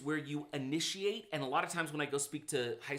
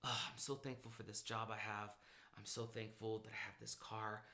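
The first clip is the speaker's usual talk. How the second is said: sincere gratitude; 5 semitones lower, 12 dB quieter than usual. Shown as a demonstration. Demonstrating gratitude self-talk.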